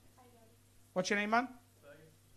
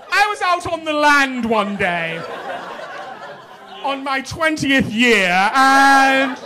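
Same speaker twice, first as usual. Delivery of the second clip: posh toff impression; 2 semitones higher than usual. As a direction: ham up a stuffy aristocrat telling his tale. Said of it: "do we have posh voices?".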